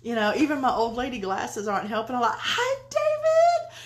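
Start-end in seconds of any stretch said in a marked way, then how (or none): 2.4-3.7 high, drawn-out delighted squeal; long sing-out hello, pitched way up; excited greeting. Real, not acted. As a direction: squeal hello to a dear friend. Greets beloved friend joining.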